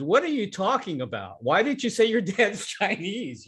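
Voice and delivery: high-pitched mock disbelief; acted-out "what on earth" questioning; retold incredulous challenge. Retold confrontation with his wife.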